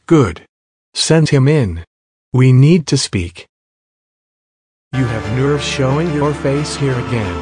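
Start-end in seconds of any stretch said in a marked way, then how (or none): 0.1-3.5 stern king. Acted. Angry king summons son.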